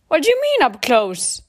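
high, loud, taken-aback demand; startled question. Acted. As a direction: leap up in pitch, then drop. Bruce startled by Steve's hint.

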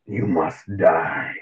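very slow, low mock death threat; menacing threat. Retelling Isaiah Stewart's reaction, a slow menacing mock threat. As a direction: drag it out like a movie villain. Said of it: "Isaiah Stewart's like".

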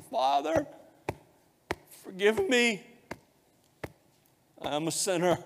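high, loud cry of a broken sinner; anguished plea. Retold or imitated. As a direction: cry out to God for mercy, voice rising high. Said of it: "fell on his face and beat his chest and said".

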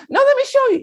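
insistent squeaky falsetto; loud, piping schoolboy impression; high-pitched kid voice. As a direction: imitate an eager pupil, very high. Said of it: "He talks like this".

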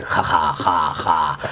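low, broken ja ja ja in the stutterer's voice; deep hammed-up stuttered chuckle; mock stuttering laugh. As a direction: play the middle stutterer chuckling in bursts. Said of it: "se comienza a reír".

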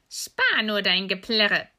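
loud, drawn-out witch rebuke; scornful old witch. Acted. Old witch to weeping Gretel, scornful rebuke. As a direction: cut off the wailing, slow and loud. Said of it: "sagte die Alte".

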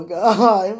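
usual speaking voice

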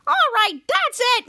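mock outburst at the end of patience; retold fed-up outburst. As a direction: play someone who has had enough. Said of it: "couldn't take it anymore".

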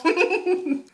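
bright high laughter. Real, not acted. Reviewer laughing at the sudden switch, high laugh. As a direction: laugh at the mood swing. Laughs after gushing quote.

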